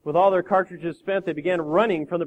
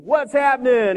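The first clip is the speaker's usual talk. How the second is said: loud, high, cocky greeting; breezy young dude; 8 semitones higher, 8 dB louder, slower than usual. Acted. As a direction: holler it like a carefree slacker. Young dude greets president casually.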